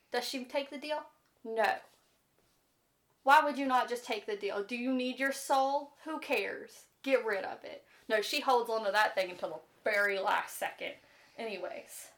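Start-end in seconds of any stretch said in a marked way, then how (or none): none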